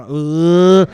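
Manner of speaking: long loud mock heave; retching. [name] promising the driver he won't, loud mock retch. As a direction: break off into an imitated retch.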